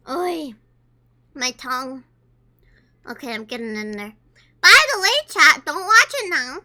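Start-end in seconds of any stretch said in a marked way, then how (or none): 4.6-6.6 louder, pushing it to chat. Real, not acted. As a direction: call out to the chat. Loud aside to chat.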